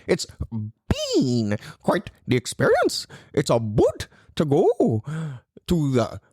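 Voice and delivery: put-on a light Canadian accent; lightly put-on Canadian accent. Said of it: "Jordan Peterson type accent or slash Canadian accent".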